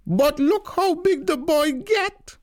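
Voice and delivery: very high, loud and slow delighted cry; bawling. A narrator's reading. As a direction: bawl it out like a proud old aunt. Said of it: "Tanti bawl".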